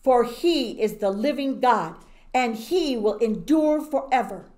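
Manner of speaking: loud, grand proclamation of a king; king proclaiming God to all his people; royal decree. Acted. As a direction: declare it to the whole kingdom.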